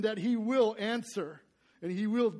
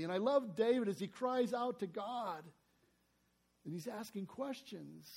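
ordinary talk

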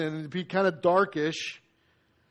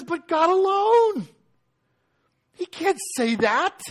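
slow, rising indignation, higher by the end; outraged muttering that swells to a protest; scandalised scribes; 4 semitones higher, slower than usual. Retold or imitated. Scribes protesting Jesus' words, indignant and rising. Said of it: "questioning in their hearts".

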